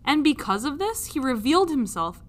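ordinary delivery

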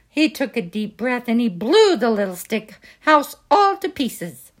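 plain reading voice

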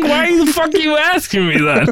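squealing laughing retort; laughing high yell. Retold or imitated. Quoting own reply, laughing.

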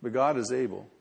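ordinary talk

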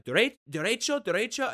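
mock the mother's Spanish directions; mimicking Spanish. Lightly imitating the driver giving Spanish directions. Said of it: "she'd be like".

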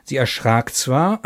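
ordinary delivery

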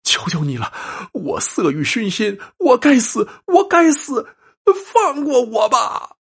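high, desperate begging; man begging the ghost to spare him; panicked pleading. Acted. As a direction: plead for your life, voice cracking. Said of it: "惊慌地叫道".

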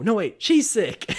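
high, loud, drawn-out correction; hammed-up slow backtrack; acted flustered correction. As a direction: scramble to fix the cover story. Acts correcting the excuse.

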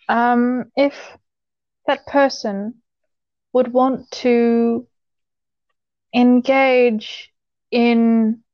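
halting, with long pauses between phrases; careful, guarded telling. Real, not acted. Telling a friend's intimate story out of courtesy, guarded and halting. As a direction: pick each word delicately, stretching and stalling. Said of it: "I'm gonna say this as ambiguous as I possibly can".

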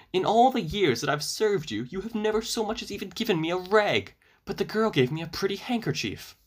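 high, timid servant voice; trembling servant. A narrator's reading. The servant answers Baba Yaga back, high and trembling. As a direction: plead in a high, meek voice. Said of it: "trembled the servant".

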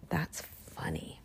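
whispered aside, unvoiced; soft amused whisper. Real, not acted. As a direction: breathe it as a private joke.

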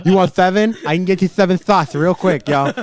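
high, loud lisp, "seven" as "theven"; hammed-up th for s in "seven"; lisped flirting voice. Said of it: "do exactly that voice".

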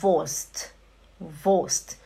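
Wurst as voscht, no R; German pronunciation. Shown as a demonstration. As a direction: Wurst said short with a silent R. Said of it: "Você fala".

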